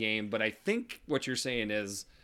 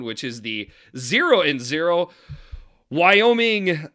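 loud, higher announcer read; podcast host intro; 4 semitones higher, 11 dB louder than usual. Acted. Host opening the game breakdown for listeners, loud and bright. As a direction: kick off the segment with big energy.

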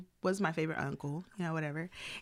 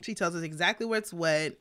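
ordinary delivery

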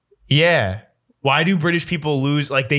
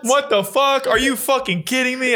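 high-pitched, outraged disbelief; mock yelling; 12 semitones higher than usual. Viewer yelling at the TV, mock yelling. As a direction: act utterly floored, pitched way up. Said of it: "Things you yell at the T V".

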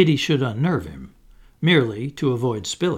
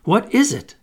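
higher, urgent curiosity; eager question; 9 semitones higher, 4 dB louder than usual. A narrator's reading. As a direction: ask it pitched up and eager. Peter eagerly questions excited Tink.

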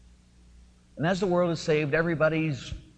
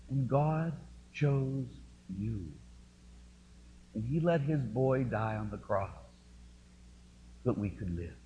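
hushed reverence; 6 dB quieter than usual. Real, not acted. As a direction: let it fall to a hush. Hushed after climax.

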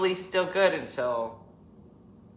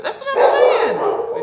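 laughing agreement; 10 dB louder, slower than usual. Real, not acted.

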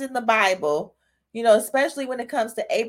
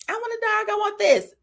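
put-on whiny kid begging; 10 semitones higher, faster than usual. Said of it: "Y'all fall for it".

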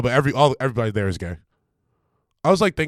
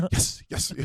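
whispered recruit reply; barked military recruit reply; 5 dB quieter than usual. Pretend. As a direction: hiss it like a recruit answering.

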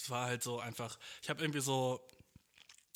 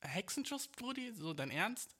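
mock-puzzled, soft and higher; incredulous listener; 5 semitones higher, 3 dB quieter, slower than usual. Listener doubting his lumbago, mock-incredulous. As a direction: mildly act out a doubting listener. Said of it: "Und du denkst jetzt so".

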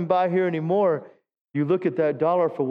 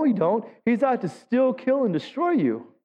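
normal talking voice

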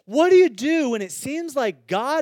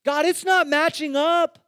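pleading complaint; 5 semitones higher than usual. Real, not acted. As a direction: cry out in frustration. Lament to God.

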